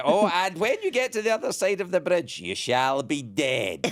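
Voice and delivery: high troll voice slowing to a laughing threat; bridge troll. Pretend. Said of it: "that's another thing the trolls do".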